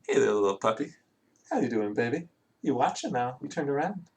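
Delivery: sweet talk to a puppy, pitch climbing with each question; pet talk. Real, not acted. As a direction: coo questions at a little dog, lilting upward. Talking to a puppy, calling it baby.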